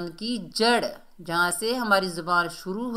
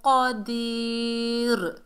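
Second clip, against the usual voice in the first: slow, higher, sustained Quran recitation; joined recitation; 3 semitones higher, much slower than usual. Shown as a demonstration. Teacher reads the whole verse joined, slow recitation. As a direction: recite continuously on a level tone, holding long sounds. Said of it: "मिलाकर पढ़ते हैं".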